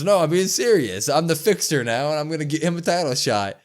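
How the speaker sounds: lightly voiced Carlito insisting he's serious; a hint of an eager pitch to the boss; insistent retelling. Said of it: "And Carlito says".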